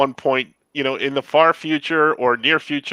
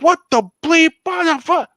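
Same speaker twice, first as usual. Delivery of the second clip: retold yelling; 14 semitones higher, 4 dB louder than usual. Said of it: "I just start yelling".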